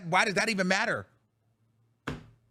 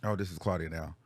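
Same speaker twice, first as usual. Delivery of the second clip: much lower, drained voice; real subdued anger; 13 semitones lower, 5 dB quieter than usual. Real, not acted. Alone on the stream after venting, subdued anger. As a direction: say it low and spent, anger turned inward. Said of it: "so mad".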